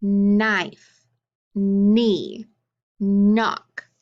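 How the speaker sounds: long held N opening "knife", "knee" and "knock"; "knife", "knee", "knock" each begun on a drawn-out hummed N; stressed initial sound. Shown as a demonstration. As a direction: stretch the first N of "knife", "knee", "knock", each word set apart. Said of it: "what letters you think make that sound".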